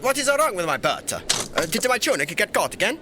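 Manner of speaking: high, flustered royal alarm; put-on emperor. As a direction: pretend indignation from a pompous ruler. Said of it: "It's so hard to keep that up".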